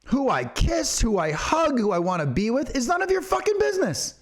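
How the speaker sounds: high-pitched, impassioned anger; indignant rant. Real, not acted. Angry rant at those judging orientation.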